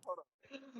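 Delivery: faint, far from the mic; quiet and distant on the call; barely audible. Real, not acted. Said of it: "you sound kinda faint".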